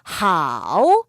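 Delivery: long 하오 sinking low, then rising; third-tone demonstration. Shown as a demonstration. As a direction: dip 하오 to the bottom and bring it up slowly. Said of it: "삼성이에요". (하오를 낮게 찍고 올리는 3성으로)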